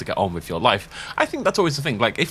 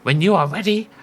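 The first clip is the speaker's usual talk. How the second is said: put-on old woman voice; 8 semitones higher than usual. Player voicing the lighthouse keeper in a spooky old woman voice. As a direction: croak it out like a spooky old lady.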